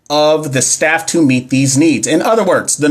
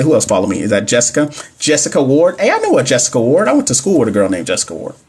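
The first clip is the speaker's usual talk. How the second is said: ordinary delivery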